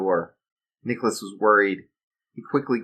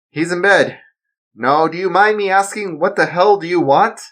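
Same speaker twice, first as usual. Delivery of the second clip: raised, louder, hostile; defiant father; 9 semitones higher, 6 dB louder than usual. Acted. Father lying to protect his son, hostile. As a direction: demand what he wants. Darren confronts villain.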